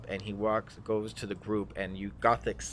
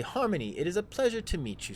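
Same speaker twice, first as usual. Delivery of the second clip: high, courteous in-character greeting; formal greeting; 9 semitones higher, faster than usual. Acted. Adventurer meets the drow, high and courteous. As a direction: introduce yourself politely, pitched well up. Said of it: "I say".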